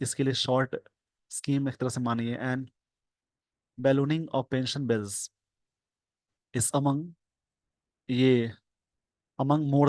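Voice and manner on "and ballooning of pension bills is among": slow dictation. Shown as a demonstration. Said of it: "slow dictation reading".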